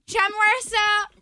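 put-on high whiny kid voice; child voice. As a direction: talk like a small boy. Child character in sketch.

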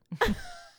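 high squealing burst of laughter; sudden laugh. Real, not acted. Friend squeals with laughter at a cracking shoulder.

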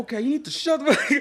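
high-pitched scolding with a laugh; going in on someone. Retold or imitated. Student telling disruptive classmate to shut up, comic outburst. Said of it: "I start going in".

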